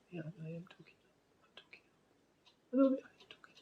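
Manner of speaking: barely audible whisper. Retold or imitated. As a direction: whisper it, hardly voiced. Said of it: "people who are really quiet".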